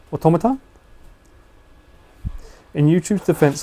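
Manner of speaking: normal talking voice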